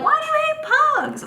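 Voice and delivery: high-pitched imitation of a baffled friend; incredulous question. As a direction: squeal the question in mock disbelief.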